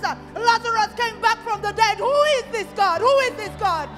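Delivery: ordinary talk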